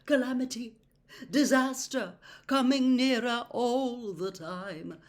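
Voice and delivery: ominous prophecy. A narrator's reading. Trelawney prophesying to Harry, ominous. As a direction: intone the doom slowly.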